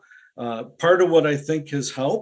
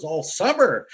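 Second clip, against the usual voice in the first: higher-pitched retold excitement; mildly hyped-up imitation; excited; 5 semitones higher than usual. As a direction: answer like an eager team captain. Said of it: "he was all excited".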